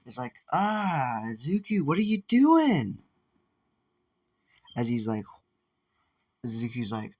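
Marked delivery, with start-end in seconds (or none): 0.5-3.0 high, slow, drawn-out call; mock teen voice. Friend calls out to Zuki in a higher voice.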